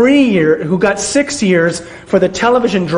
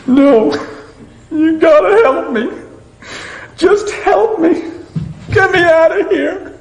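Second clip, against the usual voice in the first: much higher, slow, hammed-up tearful begging; mock weeping plea; 11 semitones higher than usual. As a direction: overplay the tearful begging for help. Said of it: "breaks down and weeps".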